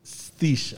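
"Thish" with th at the start and a slushy sh at the end for sheath; mispronounced sheath. Real, not acted. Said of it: "I still can't fucking say it".

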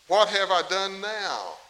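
exasperated David. Retold or imitated. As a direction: drag it out like a fed-up younger brother. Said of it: "I can just see David saying that".

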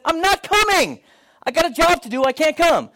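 high, emphatic mock insistence; firm refusal. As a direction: dig in your heels, half-joking. Pastor refuses to leave.

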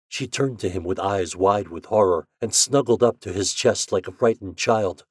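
plain reading voice

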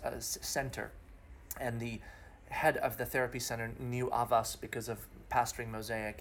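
ordinary delivery

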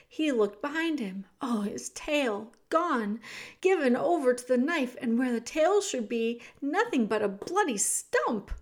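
ordinary delivery

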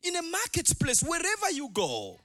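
high, loud preacher's proclamation; full-force preaching. Real, not acted. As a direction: proclaim it to the whole congregation, ringing and forceful.

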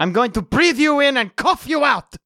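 high-pitched battle shout; hammed-up threat. Fighter threatening a small tornado, hammed-up shout. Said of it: "I just, as a threat".

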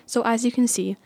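ordinary talk

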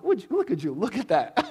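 high-pitched gloating surprise; playful "well, look at that" voice; mock surprise. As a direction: act amazed at what turned up. Mock discovery as the ball falls.

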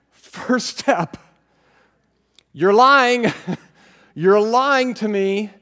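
loud, defiant rebuke; shouting back. Retold or imitated. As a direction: shout down the lie.

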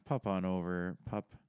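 ordinary talk

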